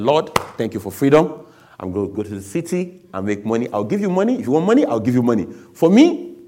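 loud, high-pitched excited plea to God; eager bargaining. Retold or imitated. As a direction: pitch the deal loudly, voice leaping up.

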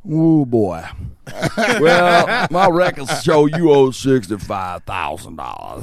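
put-on slow, loud elderly official; old guy voice. Fake IRS agent reading out the debt, old guy voice. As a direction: imitate an old man drawing out the bad news. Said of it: "He does this old guy voice".

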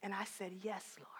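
soft, tearful whisper; crying. Real, not acted. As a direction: whisper it through tears. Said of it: "And now I'm crying".

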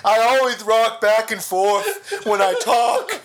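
slow, high hunchback voice; hammed-up monster on the edge of laughter; Quasimodo. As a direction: play a loud, lurching mock ogre. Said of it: "I love doing Quasimodo voice".

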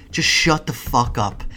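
ordinary delivery

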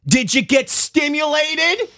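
high, slow suggestive questioning; teasing innuendo. Acted. Asking cohost about stimulus, high and slow teasing. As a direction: tease him with a sly lilt. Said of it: "teasing question to cohost".

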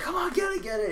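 high excited cheering. Retold or imitated. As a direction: urge the team on. Cheering for a safety.

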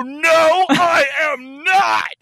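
high, drawn-out indignant shout; hammed-up wail with a swab jammed up the nose; protesting through pain. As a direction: yell it slowly, in mock agony. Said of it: "with this thing jabbed into my brain".

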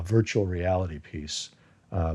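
normal talking voice

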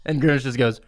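ordinary talk

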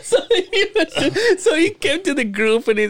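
talking through high laughter; can barely get the words out for laughing; laughing at the memory of his friend. Real, not acted. Cracking up mid-story.

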